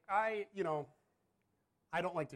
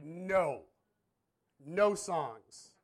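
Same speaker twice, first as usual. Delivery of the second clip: louder, flat refusal; firm loud no; 3 semitones higher, 9 dB louder, much slower than usual. Retold or imitated. Dad snapping no at daughter.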